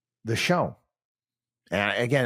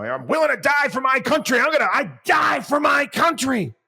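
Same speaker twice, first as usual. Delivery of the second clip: high shouted mock patriot; hammed-up shout of a flag-waver; mocking patriotic shout; 13 semitones higher, 5 dB louder than usual. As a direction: yell it like a chest-thumping blowhard. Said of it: "bit about".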